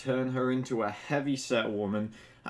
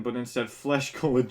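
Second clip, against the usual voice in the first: ordinary delivery, nothing marked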